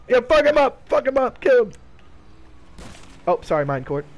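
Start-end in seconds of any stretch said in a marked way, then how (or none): 0.1-1.7 high, loud egging on; hyped shouting. Real, not acted. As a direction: cheer the attack on. Urging friend to attack.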